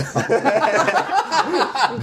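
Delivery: high squealing laugh; burst of laughter. Real, not acted. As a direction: crack up helplessly. Laughing at a text acronym.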